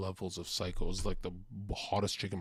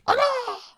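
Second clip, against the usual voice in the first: high loud yell; animal roar; 10 dB louder than usual. Acted. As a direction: let out a wild animal yell. Animal roar after whisper.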